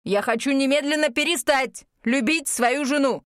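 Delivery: plain reading voice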